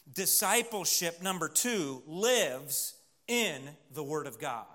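slow, spaced-out delivery; slow emphatic point. Real, not acted. Preacher announcing the second point to his congregation, slow and emphatic. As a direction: lay it out slowly, phrase by phrase.

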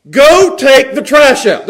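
loud, slow, high command; commanding order. Shown as a demonstration. Father orders boys to do chores, slow loud command. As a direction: order it word by word, slowly and loudly. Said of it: "There's a difference there".

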